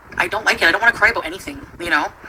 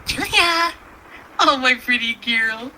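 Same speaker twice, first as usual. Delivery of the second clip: cooing to pet; 7 semitones higher, slower than usual. Real, not acted. Calling her cat Julia over, slow tender coo. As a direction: call the cat sweetly, then melt.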